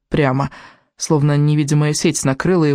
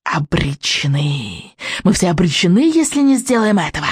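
hissing witch. A narrator's reading. Witch warning the Vikings they are doomed, hissing. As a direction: hiss out doom as the witch. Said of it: "прошипеть".

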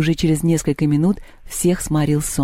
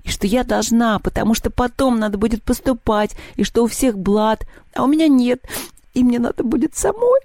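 high, building tearful complaint; acted crying, climbing higher to the end; 7 semitones higher than usual. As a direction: wail your grievances like an upset girl. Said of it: "заплакала Маша".

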